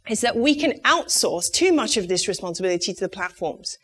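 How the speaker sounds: ordinary talk, nothing marked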